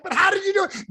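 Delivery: very high, loud, amazed insistence; excited disbelief. Acted. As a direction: demand the secret, bursting with wonder. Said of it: "Now he's excited".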